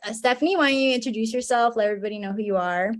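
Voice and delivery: ordinary talk, nothing marked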